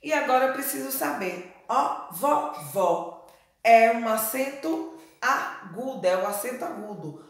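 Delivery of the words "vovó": "vovó" said slowly with open ó; "vovó" drawn out with open vowels. Shown as a demonstration. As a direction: separate "vo-vó", keeping the o open. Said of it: "O está com o som aberto".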